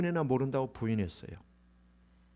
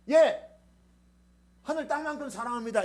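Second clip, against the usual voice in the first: eager answer; 11 semitones higher than usual. Pretend. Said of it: "얼마나 사랑하니?".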